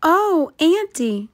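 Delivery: put-on high, sing-song little girl's cry; Little Red crying out. A narrator's reading. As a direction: call out high and sweet to her auntie. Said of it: "cried Little Red".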